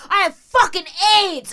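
high, loud angry announcement; acted-out raging stranger; aggressive taunt. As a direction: throw it at them like a threat. Woman in story threatens her.

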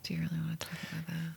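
fast, breathy hushed whisper. Real, not acted. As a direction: lean in and whisper the question quickly. Teasing hushed question to co-host.

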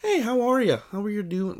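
high, sing-out polite greeting; overly friendly. Retold or imitated. Friendly Minnesotans greeting everyone, imitated over-nice. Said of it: "they're all just really nice".

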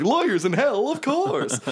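very high, comic lawyer voice; silly high-pitched chorus of infernal lawyers; hell lawyers. Acted. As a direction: pipe it up like cartoon lawyers. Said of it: "hilarious hell lawyers who are like".

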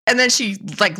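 ordinary talk

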